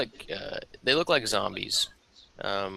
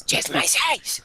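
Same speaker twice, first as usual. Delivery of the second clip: much higher, in-character squeal; squeaky character voice; 4 dB louder, slower than usual. Acted. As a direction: say it high and squeaky, playing the character. Slug voiced putting on the shirt.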